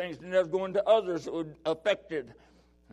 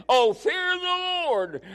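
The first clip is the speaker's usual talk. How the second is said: much higher, slow, loud cry; shouted scripture; 12 semitones higher, 5 dB louder, much slower than usual. Real, not acted. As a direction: call it out to the saints.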